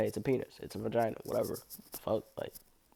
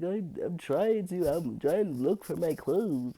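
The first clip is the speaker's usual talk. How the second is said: higher, slow, fumbling voice for the naked guy; mock-innocent excuse, voiced higher; flustered guy; 7 semitones higher than usual. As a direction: play him sheepish and lost. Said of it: "He was like".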